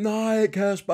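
high-pitched mock cry of protest; retold yell, pitched well up; Bubber's retold yell. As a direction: imitate someone calling out in dismay, not at full volume. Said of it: "Så kan man bare høre Bubber råbe".

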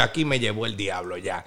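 very low and soft, giving up; doomed mutter. Retold or imitated. Telling friends he thought he was done, low resigned mutter. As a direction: mutter it like a lost man. Thinking he's finished.

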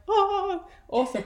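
re-enacted wide-eyed wonder; retold delight. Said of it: "I was like".

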